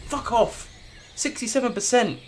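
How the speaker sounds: high, indignant yell at the dice; outraged outburst. Real, not acted. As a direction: protest the failed roll, pitched high. Failed roll at sixty-seven percent.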